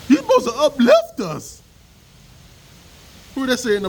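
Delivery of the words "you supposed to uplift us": high, whiny mock voice; mocking whiny critic. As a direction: mimic the complaining woman. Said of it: "mocking critic's voice".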